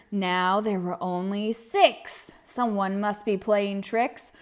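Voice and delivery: ordinary delivery, nothing marked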